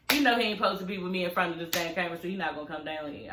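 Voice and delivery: ordinary talk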